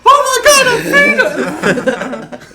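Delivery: very high, loud, drawn-out shriek breaking into laughter; mock shocked shriek. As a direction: scream it like a horrified protester. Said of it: "they go".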